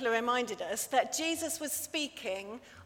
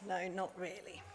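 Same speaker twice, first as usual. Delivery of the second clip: much softer, weary reply; tired Daddy Bear; 4 semitones lower, 12 dB quieter than usual. Acted. Daddy Bear answers his wife after a sleepless night, soft and weary. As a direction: mumble it, worn out after no sleep. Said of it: "and he says".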